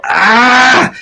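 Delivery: sharp high cry of effort; pained yell. Acted. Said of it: "You hear Honor start to yell".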